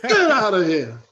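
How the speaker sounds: loud, high crowing with a laugh; exuberant boasting. Retold or imitated. [name] showing off the house he just bought, exuberant boasting. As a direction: imitate a gleeful shouted brag.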